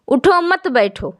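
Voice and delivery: اٹھو مت run together, then بیٹھو; wrong pause. Shown as a demonstration. Teacher demonstrating a misplaced pause to students, phrasing اٹھو مت as one unit. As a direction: pause after مت so it means don't stand up, sit. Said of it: "میں کیا کہہ دے رہی ہوں".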